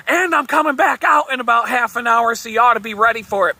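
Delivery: high, loud, fast shouted warning; yelled threat. Retold or imitated. The boss yelling back from his door, loud threat. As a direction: shout it back over your shoulder. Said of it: "he yells back".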